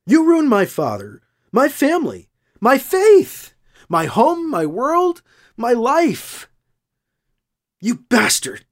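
furious accusation that builds to a shout; building fury. A narrator's reading. Ruth accusing him, building fury.

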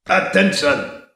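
a guard calling through a loudspeaker; amplified guard voice. Acted. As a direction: announce it over a speaker system. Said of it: "The amplified voice of a Tecta guard".

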